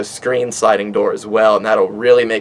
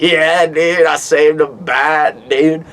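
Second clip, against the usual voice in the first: surfer dude character; 3 semitones higher, much slower than usual. Pretend.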